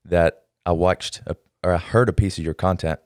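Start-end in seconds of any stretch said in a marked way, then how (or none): none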